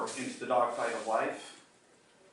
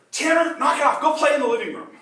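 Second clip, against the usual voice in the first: put-on much higher and louder, snapping at the kid; scolding shout; 11 semitones higher, 9 dB louder, faster than usual. Grandfather yelling at grandson squeezing past his legs, loud and high. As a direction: yell at the boy underfoot.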